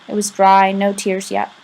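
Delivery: usual speaking voice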